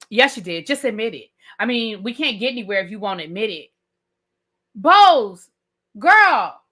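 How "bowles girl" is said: loud, punched words with pauses; exasperated call-out. Real, not acted. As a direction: call them out, word by word. Addressing Bowles in exasperation.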